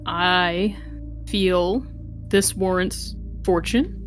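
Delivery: ordinary talk, nothing marked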